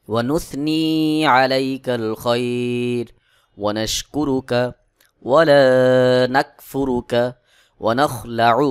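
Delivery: slow, measured Arabic chant with long-held vowels; Quran-style recitation. Shown as a demonstration. Teacher reciting Dua Qunut for learners to memorise, slow chanted recitation. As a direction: recite phrase by phrase, pausing for learners to follow. আবার প্রথম থেকে চার line পড়ে নিব.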